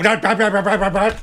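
imitating rushed gibberish; mock motormouth talk; mocking rapid babble. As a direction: rattle it off like a machine gun. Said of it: "you go, ... Yeah, Bud, slow down".

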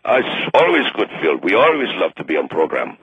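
very low phony Russian voice; fake Russian accent. Russian delegate greeting the host, fake Russian accent. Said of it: "the gentleman from Russia".

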